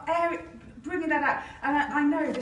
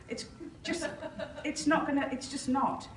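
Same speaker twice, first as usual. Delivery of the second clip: usual speaking voice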